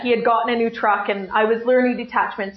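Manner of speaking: normal talking voice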